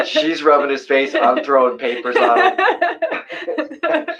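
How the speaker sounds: words bubbling through real laughter; laughing while telling. Real, not acted. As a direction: tell it while you can't stop giggling. Laughing at the dog's antics.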